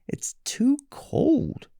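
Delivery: put-on high, slow girlish voice; too cold complaint. Goldilocks sighs over the cold oatmeal, high and slow.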